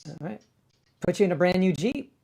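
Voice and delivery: ordinary talk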